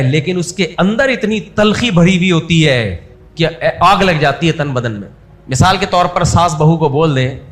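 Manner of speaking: ordinary talk, nothing marked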